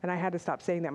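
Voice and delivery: ordinary delivery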